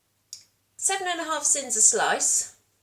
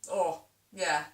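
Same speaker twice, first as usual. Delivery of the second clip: ordinary delivery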